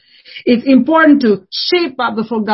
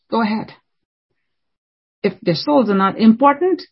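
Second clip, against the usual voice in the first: ordinary delivery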